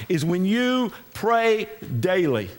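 ordinary delivery

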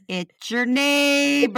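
slow, drawn-out "It's your neighbor". Retold or imitated. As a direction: say it slowly like the neighbor. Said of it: "he said it just like that".

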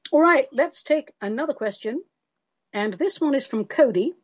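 ordinary talk

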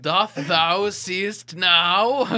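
slow, loud, laughing mock Shakespeare; hammy stage actor. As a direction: ham it up like an old stage player. Mock old-English line, laughing.

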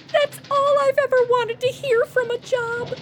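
high-pitched gushing delight; thrilled. Acted. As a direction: gush as if a dream came true. Thrilled at promised expense money.

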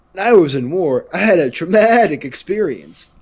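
loud, high-pitched sketch impersonation; Guy Fieri with PTSD. Retold or imitated. Quoting a comedy sketch to friends, loud mock impersonation. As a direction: belt it out as a hammed-up war veteran. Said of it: "he plays Guy Fieri, but if he had P T S D".

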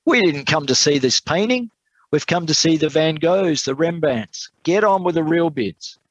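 high-pitched angry protest; angry shout. A narrator's reading. Bidder to the auctioneer about the wrong painting, calling out angrily. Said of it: "Another voice shouted out angrily".

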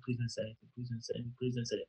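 student begging silently as the teacher walks over; pleading under breath. Retold or imitated. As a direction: pray it silently, repeated. Silent plea as teacher approaches.

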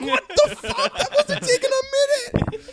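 high, loud disbelieving shout; incredulous yelling. Retold or imitated. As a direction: yell it in shock and laughter. Retelling friend's giant hit.

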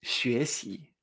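"xuexi" with two rising glides; tones two-two. Shown as a demonstration. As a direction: lift both syllables of "xuexi". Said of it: "dos dos".

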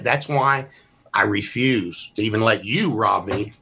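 usual speaking voice

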